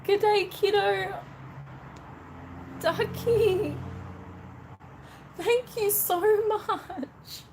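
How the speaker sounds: high, slow, tearful thanks; tearing up. Real, not acted. Live streamer thanking Ducky for a gift, tearing up. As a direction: choke up with real gratitude. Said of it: "I'm so sorry that I'm tearing up".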